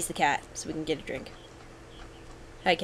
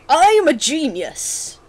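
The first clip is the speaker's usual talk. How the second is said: loud, high, drawn-out boast; triumphant; 7 semitones higher, 7 dB louder, slower than usual. Real, not acted. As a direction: crow about your escape, stretching it out. Celebrates losing the cat.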